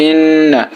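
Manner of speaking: ইন্না with the doubled n hummed through the nose; ghunnah. Shown as a demonstration. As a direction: hold a nasal hum on the n of ইন্না. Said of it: "গুণনা করে পড়তে হয়।". (ইন্না-র নুনে গুন্নাহ)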